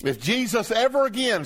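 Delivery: ordinary talk, nothing marked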